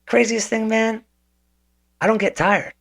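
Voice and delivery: very high, delighted reveal; spring the secret with glee; gleeful coach. Retold or imitated. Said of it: "He goes".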